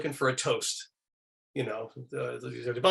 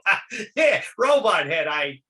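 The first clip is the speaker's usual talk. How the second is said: lightly voiced cartoon dad catching on late, higher and louder; George Jetson; 7 semitones higher, 5 dB louder than usual. Retelling the Jetsons voice actor doing the delayed take, lightly voiced. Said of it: "used to do the voice of George Jetson".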